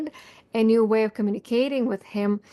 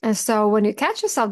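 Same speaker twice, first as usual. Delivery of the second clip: ordinary delivery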